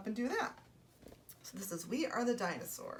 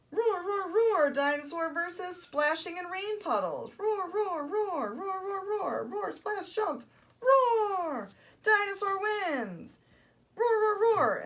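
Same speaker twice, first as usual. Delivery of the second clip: high, relentless excited roars; roaring toddler dinosaur; 11 semitones higher, 7 dB louder, slower than usual. Acted. Storyteller has dinosaur conquer rain puddles, high triumphant roaring. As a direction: keep roaring right up to the triumph.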